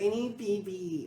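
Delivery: put-on slow, babyish whine of a drunk friend; little kid voice. Imitating the drunk friend's babyish voice. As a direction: mimic her childish begging. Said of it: "Like, in that voice, in that tone of voice".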